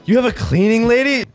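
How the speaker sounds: incredulous repeat. Real, not acted. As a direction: ask it in high-pitched astonishment. Incredulous repeat, more emphatic.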